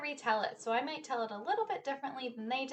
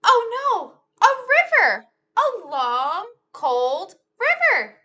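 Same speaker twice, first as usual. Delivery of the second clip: higher, louder oh no; mock-alarmed storytime cry; 10 semitones higher, 14 dB louder, slower than usual. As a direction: exclaim as if spotting danger. Alarm at new obstacle.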